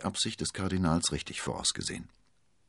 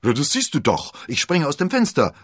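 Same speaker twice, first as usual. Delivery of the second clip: loud, high, cheerful shout; calling out merrily; 9 semitones higher, 10 dB louder than usual. A narrator's reading. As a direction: call back blithely. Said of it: "rief D'Artagnan lachend".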